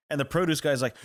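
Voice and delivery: ordinary talk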